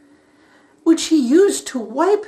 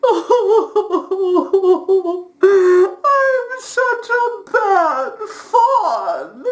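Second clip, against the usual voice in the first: slow, high, tearful whimper; crying faun; 4 semitones higher, 3 dB louder, much slower than usual. Acted. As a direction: sob it out, ashamed. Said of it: "why you are crying".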